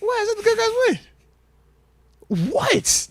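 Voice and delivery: high, drawn-out disbelieving protest; incredulous. Pretend. Host protesting the co-host's doubt about his pick, incredulous. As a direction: squeal it in mock outrage, slowly. Mock disbelief at being questioned.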